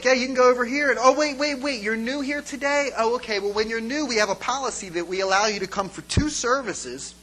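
brisk mock-helpful church usher; backtracking usher. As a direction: imitate an officious greeter catching themselves. Acting usher backtracking with newcomers.

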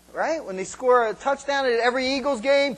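normal talking voice

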